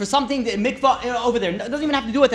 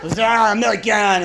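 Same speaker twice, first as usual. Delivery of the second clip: "American" drawn out slowly and louder, French style, as zəmɛlikæn; mock French accent; 2 semitones higher, 6 dB louder, slower than usual. A Frenchman refusing to help the tourist, in a slow, louder mock French accent. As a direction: stretch "American" dismissively in a hammed-up French accent. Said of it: "Be like".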